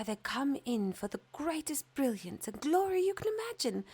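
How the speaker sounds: gossiping little birds; sparrow voices. Acted. Grey sparrows telling the fir about Christmas rooms, high and chattering.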